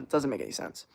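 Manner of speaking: ordinary talk, nothing marked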